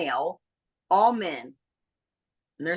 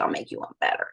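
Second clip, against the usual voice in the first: conspiratorial whisper; faster than usual. Retold or imitated. Attendant promises a better drink.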